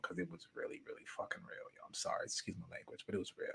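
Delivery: hushed whisper over the swearing; sheepish whisper of an apology. Real, not acted. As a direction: drop to a whisper for the curse.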